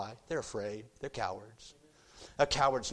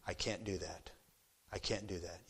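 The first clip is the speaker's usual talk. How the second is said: quiet refusal; 5 semitones lower, 9 dB quieter than usual. Retold or imitated. Jaylene refuses coaches again.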